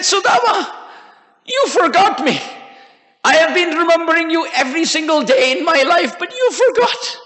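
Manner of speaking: high-pitched, wounded plea; tearful reproach. A narrator's reading. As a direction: reproach a dear friend, voice lifted high. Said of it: "Krishna was bathing Sudama with his tears".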